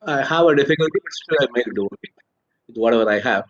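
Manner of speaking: normal talking voice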